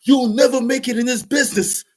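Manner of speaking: high, loud mock scolding; frustrated put-down. Producer scolding the rapper, high mock scolding. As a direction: jeer it like a fed-up producer. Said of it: "He was like / he was getting frustrated".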